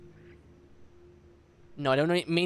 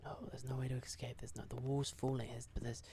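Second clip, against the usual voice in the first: hushed whisper to himself; 3 semitones lower, 21 dB quieter than usual. Real, not acted. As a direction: whisper it, barely voiced.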